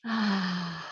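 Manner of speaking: long audible sigh out; settling exhale. Real, not acted. Teacher opening a short group sitting, settling sigh. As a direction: let out a long, settling breath.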